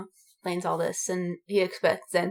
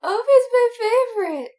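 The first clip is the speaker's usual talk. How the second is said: much higher and louder, squealing with delight; excited gushing; 13 semitones higher, 9 dB louder than usual. Real, not acted. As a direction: gush over your favourite like a fan. Gushing about favourite character.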